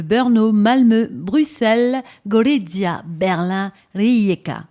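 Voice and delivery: each city called out loud; announced city list. Acted. Host announcing the cities of the trip, loud and punchy. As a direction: call out the cities like a roll call. Listing cities as show opener.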